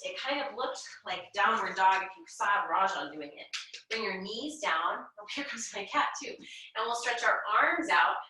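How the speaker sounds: usual speaking voice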